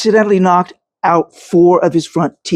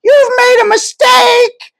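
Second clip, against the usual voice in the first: much higher, slow shrill crone's shout; shrew's shrieking protest; 4 dB louder, slower than usual. A narrator's reading. The shrew protests to the armed riders, shrieking. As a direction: screech the protest in panic. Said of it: "I managed to respond".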